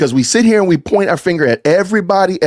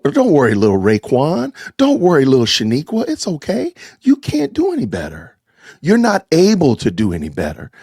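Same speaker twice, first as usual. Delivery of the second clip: mock coddling; 3 semitones higher than usual. Commentator imitates people handing out excuses to children, mock coddling. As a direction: coo it like babying a small child, mockingly.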